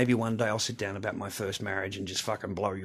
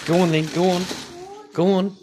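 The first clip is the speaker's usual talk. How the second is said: loud shooing; 9 semitones higher, 11 dB louder than usual. Real, not acted. Shooing child inside.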